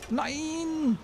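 high drawn-out no, falling; dismayed no. Real, not acted. Player watching the enemy dodge, disbelieving wail. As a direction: let it out in disbelief.

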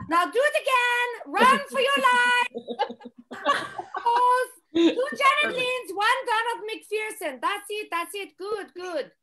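channel her bossy coach voice from the boards; impression of the late coach. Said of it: "I do a good impression of your mom".

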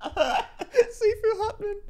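high, slow, laughing repeat; real laughter. Real, not acted. Viewer repeating a silly nickname from the show, laughing. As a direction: laugh your way through the nickname.

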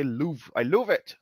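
mocking a northern English "love". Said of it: "like that".

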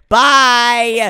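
loud, hurried goodbye; shouted farewell. Acted. Patient escaping the nurse, shouted farewell. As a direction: call it out while bolting.

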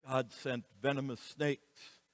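usual speaking voice

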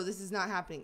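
ordinary talk, nothing marked

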